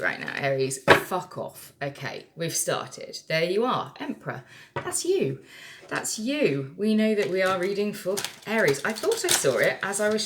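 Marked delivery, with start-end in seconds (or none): 4.7-5.4 much higher, delighted pointing; excited squeak. Real, not acted. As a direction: squeal it at the card. Points out the Emperor card.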